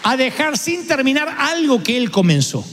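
slow, ringing declaration; preaching. Acted. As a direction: proclaim it slowly to the crowd.

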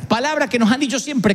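usual speaking voice

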